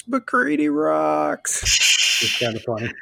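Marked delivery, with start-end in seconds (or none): 0.1-1.6 high, drawn-out mock fan yell; long, high-pitched imitation crowd shout; imitated scream. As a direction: imitate a concert heckler yelling, words stretched out. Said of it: "He kinda screamed it out".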